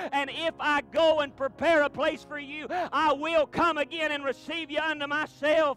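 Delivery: loud, high preacher cadence, fast at the start; preaching at full force. Acted. Pastor proclaiming the return to the church, preached hard. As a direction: climb toward the shout of the archangel.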